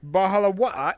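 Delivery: playful British impression; British accent. Teasing a friend about British speech, mock British accent. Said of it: "talk with a British accent".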